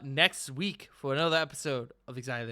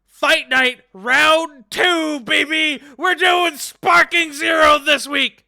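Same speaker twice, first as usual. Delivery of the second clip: high, slow hype shout; hyped announcer shout; 13 semitones higher, 17 dB louder, much slower than usual. Real, not acted. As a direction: announce it like a fight promoter. Hyped announcement of fight night.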